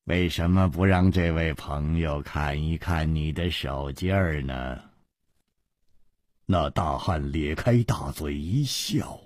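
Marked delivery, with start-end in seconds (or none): 0.1-4.8 low, soft villain's suggestion; understated, sinister invitation; quiet menace. Acted. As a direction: say it low and softly, with veiled threat. Villain coolly menaces through his henchman.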